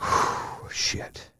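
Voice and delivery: a breathy whispered "shit" under the breath; whispered curse. Retold or imitated. Dad bracing for the cold shower, whispering.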